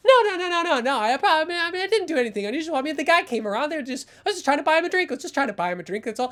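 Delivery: high, rapid babbling denial; cornered coward, words tumbling out; panicked creep making excuses. Acted. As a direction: gabble excuses in a pleading rush.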